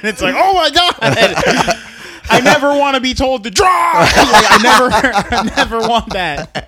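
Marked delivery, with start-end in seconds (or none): 0.4-4.1 high, loud comic freak-out; hammed-up alarmed outburst; panicked shout. Acted panic at being told drive. 4.1-6.7 shouting through laughter, pitched high; comic loud outburst; horrified yelling. Acted. Comic horror at the story.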